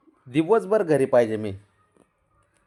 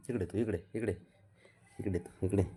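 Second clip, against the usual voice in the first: much softer, lower calling; soft coaxing; 5 semitones lower, 12 dB quieter than usual. Real, not acted. Softly calling baby over. (हळू आवाजात बोलावणे)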